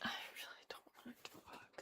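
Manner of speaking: whispered, dragging your feet; reluctant whisper. Real, not acted. Talking herself out of cleaning, whispered. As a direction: breathe it out like you'd rather not.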